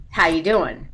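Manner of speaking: "How you doing?" said back flat with a falling tune; Jersey greeting. Shown as a demonstration. A Jersey local answering in a flat falling Jersey manner. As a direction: answer the question with the same question, falling. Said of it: "the correct answer is".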